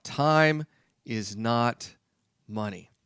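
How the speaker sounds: very slow, word by word, fading on "money"; slow, spaced-out emphasis. Shown as a demonstration. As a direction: drag each word out to drive it home. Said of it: "I'll tell you that right now".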